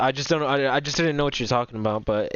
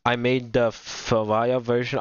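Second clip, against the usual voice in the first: ordinary talk, nothing marked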